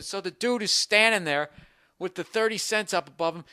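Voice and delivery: ordinary talk, nothing marked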